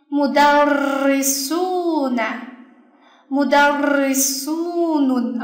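mudarrisuna, mudarrisunun very slowly, su drawn out and stressed; stress on long su. Shown as a demonstration. Teacher tests the rule aloud, stressing long su in mudarrisuna, mudarrisunun. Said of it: "那是不是重音就在它身上？".

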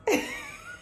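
a short high squeal of a laugh. Real, not acted. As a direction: squeal with delight. Laughs at landing the job.

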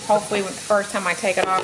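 usual speaking voice

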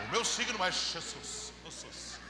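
slow parody of a devout believer, fading to a whisper; mock pious intonation. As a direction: imitate church piety, let the last word trail into breath. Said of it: "Com essa entonação de voz e tudo". (imitação de crente devoto, lenta, terminando sussurrada)

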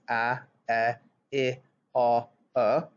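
short vowel sounds a, e, i, o, u, each clipped and clear. Shown as a demonstration. Teacher modelling the short vowel sounds for young learners. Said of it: "those short sounds".

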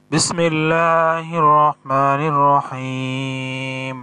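slow, drawn-out melodic recitation; Quran recitation. Shown as a demonstration. Teacher reciting Surah Fajr for students, slow melodic chant. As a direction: chant each verse slowly, stretching the vowels.

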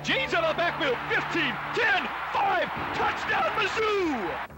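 high, drawn-out excited call; touchdown call. Real, not acted. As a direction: stretch the count into touchdown. Radio call of a touchdown pass.

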